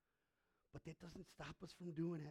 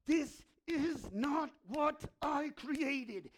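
slow sorrowful thought; 9 semitones higher, 12 dB louder, much slower than usual. Retold or imitated. Jesus grieving at tomb.

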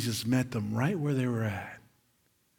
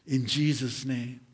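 normal talking voice

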